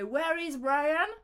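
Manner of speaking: slow, high, over-articulated schoolbook English; mock textbook English. Mocking the school lesson with a slow stilted question. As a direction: recite it like a stiff classroom dialogue.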